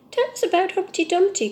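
high, eager plea; eager cry. Acted. Mice beg the tree for the story, eager. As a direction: beg like excited little mice. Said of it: "cried the mice".

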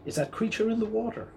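put-on a young dinosaur asking the herd about his reflection, puzzled and high; wondering question. A narrator's reading. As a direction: ask in wide-eyed wonder. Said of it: "and he asked them".

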